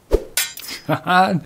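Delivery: a light teasing laugh mid-duel. Acted. Tutor dodging the princess's thrust, teasing laugh.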